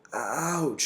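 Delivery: sudden pained ouch; loud ouch. A narrator's reading. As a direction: yelp it out in pain. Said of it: "he said loudly".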